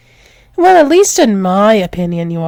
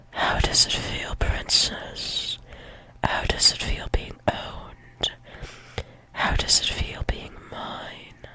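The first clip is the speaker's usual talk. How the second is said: soft, possessive whispering; intimate whisper; 15 dB quieter than usual. Acted. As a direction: whisper each question close to your partner. Intimate questions to a partner.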